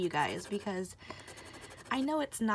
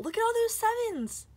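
much higher and louder, gushing; delighted squeal; 13 semitones higher, 7 dB louder than usual. Real, not acted. Admiring all the sevens on the winning ticket, squealing.

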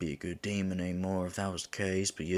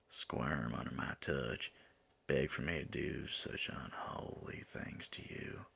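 slow, low, half-whispered tease; seductive murmur; 5 semitones lower, 6 dB quieter than usual. Acted. Demon teasing an angel about their trysts, seductive murmur. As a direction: draw it out softly, almost whispering.

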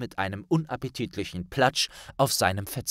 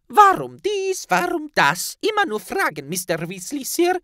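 a tiny elf scolding in a squeak; squeaky house-elf; 6 dB louder than usual. Acted. Elf Flabbles chides Ron in a high squeak.

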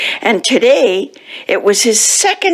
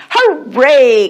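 higher, drawn-out cheer; cheering call; 6 semitones higher than usual. A narrator's reading. Cats hearing it is milk time, high cheer. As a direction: call it out gleefully, pitched up. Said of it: "called the cats".

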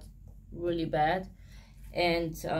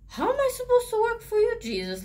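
much higher, fast, desperate question to God; high-pitched frustrated pleading; exasperated plea; 4 dB louder, faster than usual. Retold or imitated. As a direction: throw the question up at heaven, bewildered. Retelling her plea to Jesus.

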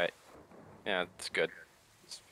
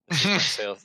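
salesman voice; 5 semitones higher, 9 dB louder than usual. Acted. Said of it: "Gaspar's salesman voice".

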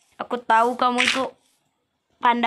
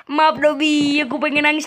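normal talking voice

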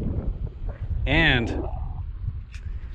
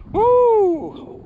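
excited whoop; 5 dB louder than usual. Real, not acted. Overwhelmed by the catch.

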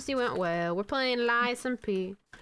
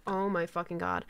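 ordinary talk

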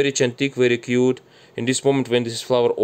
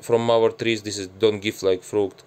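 ordinary delivery

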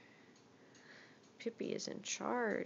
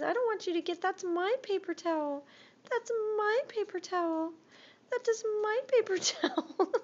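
high, loud voicing the cat; squeaky bossy pet voice; pet's voice; 10 semitones higher, 6 dB louder than usual. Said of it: "She says".

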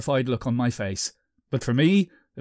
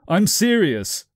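raised and higher, insisting; insistent; 6 semitones higher, 5 dB louder, slower than usual. Acted. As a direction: say it like you mean it. Insisting to girlfriend.

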